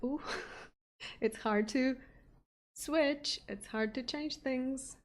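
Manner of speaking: usual speaking voice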